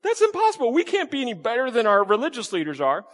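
high, incredulous mock outcry; acting out the listeners' disbelief, high and astonished; shocked disbelief. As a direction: play the stunned crowd, pitch shooting up. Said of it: "they start going".

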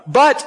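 "But" lifted high and punched; stress on but. Shown as a demonstration. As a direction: hit "But" hard to set up the contrast. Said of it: "Think about it".

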